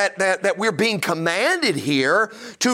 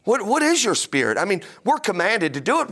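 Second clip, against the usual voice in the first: ordinary talk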